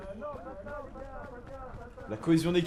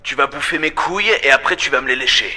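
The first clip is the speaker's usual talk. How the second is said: loud, lowered, abusive AI voice; Grok impression; 5 semitones lower, 5 dB louder, faster than usual. Player as the other app refusing to motivate, rude loud impression. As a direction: rude bot telling the user off. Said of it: "salut Grok, tu peux faire un discours motivant".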